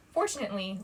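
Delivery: ordinary delivery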